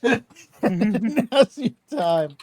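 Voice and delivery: laughing repeat, drawn out. Real, not acted. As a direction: say it high and loud through laughter. Same words repeated through laughter.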